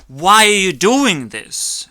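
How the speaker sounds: slow, loud, high demand; angry yell. Acted. Mock confrontation with an imagined loser, loud angry yell. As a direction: hurl it at him in outrage.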